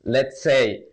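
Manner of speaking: ordinary delivery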